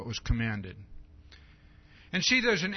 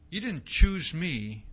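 slow emphatic; 3 dB louder, slower than usual. Shown as a demonstration. Verse repeated slowly.

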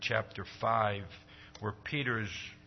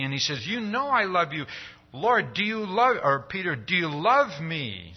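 higher, louder preaching voice; fervent preaching; 7 semitones higher, 4 dB louder than usual. Acted. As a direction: build the sermon to a passionate pitch. Preaching rises to full force.

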